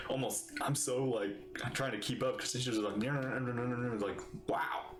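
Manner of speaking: ordinary talk, nothing marked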